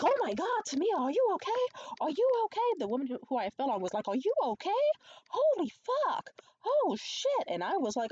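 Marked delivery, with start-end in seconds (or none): 0.0-2.7 high, loud mock panic; acted-out flurry of loud, high-pitched concern; alarmed bystanders. Bystanders rushing to a fallen woman, high mock alarm. Bystanders react to her fall. 4.1-7.5 very high, loud imitated shock; mock alarm. Woman checking on whoever fell on her, shrill mock alarm.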